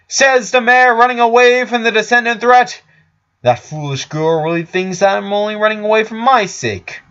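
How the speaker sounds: loud and high, scornful; shouted retort. Acted. Richard shouts back at Flower while escaping over rooftops.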